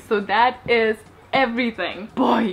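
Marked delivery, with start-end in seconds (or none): none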